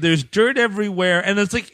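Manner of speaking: ordinary talk, nothing marked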